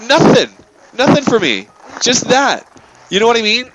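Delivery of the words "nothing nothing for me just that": mock curt refusal. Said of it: "And she goes".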